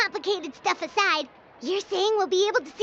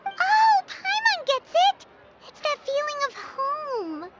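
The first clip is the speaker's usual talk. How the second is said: excited agreement; 5 semitones higher, slower than usual. Acted. Paimon excitedly relating.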